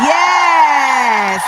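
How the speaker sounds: loud triumphant yes. Real, not acted. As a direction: celebrate finding it. Triumph finding the map pin.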